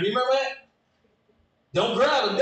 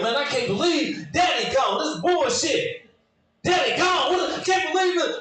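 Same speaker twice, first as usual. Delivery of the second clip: upset brother; 7 semitones higher than usual. Retold or imitated. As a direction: rant high and worked up. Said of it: "He's like".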